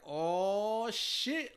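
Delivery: high surprised oh shit; mock surprise. Everybody reacting to immigration reform, mock surprise. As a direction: act caught off guard. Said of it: "everybody was like".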